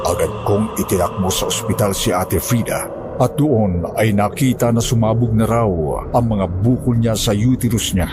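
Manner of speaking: ordinary delivery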